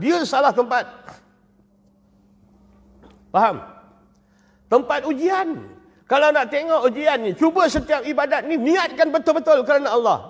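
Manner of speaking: high, loud preacher's voice; fervent preaching. Acted. Preacher to a mosque crowd, fervent preaching. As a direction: preach it out with force.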